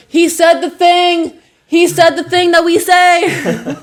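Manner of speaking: very high, loud, drawn-out squeal; gleeful fan outburst, stretching the words into laughter; thrilled exclamation. Retold or imitated. Retells thrilled reaction to TV show.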